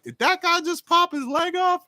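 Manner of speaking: imitating the class's disbelief; stunned classmates. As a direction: squeal it like a shocked student. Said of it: "everybody in the class was like".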